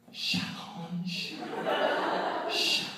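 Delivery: whispered, puzzled reading of the name tag; mock puzzled whisper. Partygoers reading the name tag, whispered and puzzled. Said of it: "they're like".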